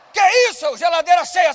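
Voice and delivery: astonished wife. Acted. Wife opens the full fridge.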